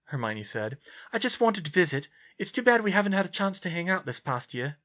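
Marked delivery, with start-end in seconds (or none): 1.1-4.8 higher-pitched character voice, earnest; raised, sincere dialogue voice; earnest young heroine. Acted. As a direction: voice the concerned friend, pitched up.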